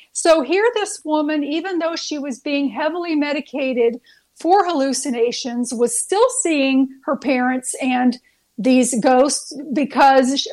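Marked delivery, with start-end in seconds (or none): none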